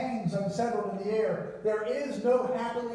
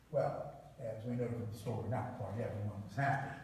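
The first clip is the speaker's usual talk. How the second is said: dropped low and soft, ominous; hushed; 13 semitones lower, 10 dB quieter than usual. Real, not acted. Preacher hinting not everyone was happy, hushed low. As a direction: let it sink to a low hush.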